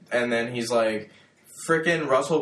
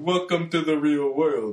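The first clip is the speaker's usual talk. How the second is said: Lawrence Fishburne impression; 4 semitones higher than usual. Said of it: "That's not how he talks".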